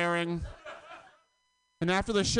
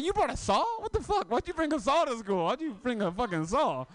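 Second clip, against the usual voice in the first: high, incredulous disbelief; a teacher who can't believe the saw; shocked; 7 semitones higher than usual. Retold or imitated. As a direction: gasp out the question in shock. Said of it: "I'm like".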